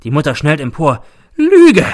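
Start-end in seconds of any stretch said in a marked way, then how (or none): 1.3-1.9 piercing high shriek of accusation; outraged mother's high scream; screech. A narrator's reading. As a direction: screech it at full voice. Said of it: "kreischt sie".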